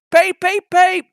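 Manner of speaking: high, loud piping peep; high engine whistle. Acted. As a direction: toot like a small engine. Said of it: "whistled Thomas".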